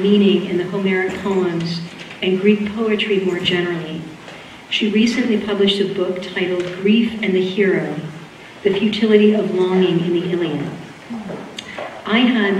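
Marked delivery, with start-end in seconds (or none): none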